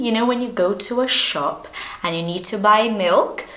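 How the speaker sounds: normal talking voice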